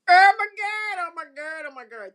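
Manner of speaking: loud, high burst of joy; excited outburst. Real, not acted. Excited about son's EP.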